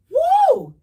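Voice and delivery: sudden high, loud whoop. Real, not acted. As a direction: let out a shrill excited woo. Excited outburst mid rant.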